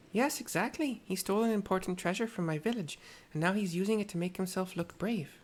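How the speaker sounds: put-on Tepeke's mixed accent. Tepeke scolds the wandering girl in a mixed accent. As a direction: scold the child gently in a put-on foreign accent. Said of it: "the accent I've chosen".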